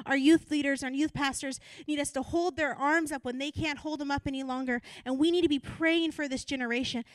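rally the congregation; impassioned sermon climax; full-force preaching. Real, not acted.

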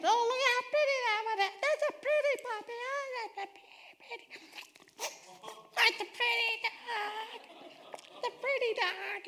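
put-on very high, breathy-fast child voice cooing over a puppy; little kid gushing. Dad imitating his little boys cooing over puppies, high kid voice. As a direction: gush like a small boy at the pet store.

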